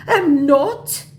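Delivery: high, loud denial; protesting mock scammer.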